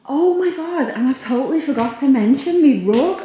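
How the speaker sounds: gushing makeover reveal. Real, not acted. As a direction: burst out with delight. Sudden excited remembering of rug.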